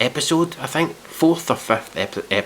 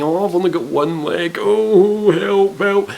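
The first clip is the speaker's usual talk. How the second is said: high, loud hammed-up plea; mock wailing; 9 semitones higher, 5 dB louder, slower than usual. Mimicking a one-legged contestant's sob story, mock wailing. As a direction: wail it like a badly faked victim.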